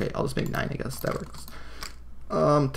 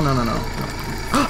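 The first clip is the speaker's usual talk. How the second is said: usual speaking voice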